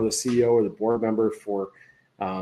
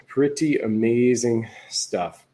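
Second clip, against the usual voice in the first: slow, drawn-out repeat; slow it down and savor each word; pretty amazing stuff said slowly; slower than usual. Real, not acted.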